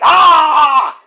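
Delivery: loud, high angry yell. Retold or imitated. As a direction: yell it out in anger. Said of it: "I was like".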